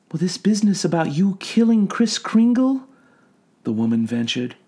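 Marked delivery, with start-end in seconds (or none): 0.1-2.8 soothing clinical questioning; calm doctor voice. A narrator's reading. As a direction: gently probe a patient.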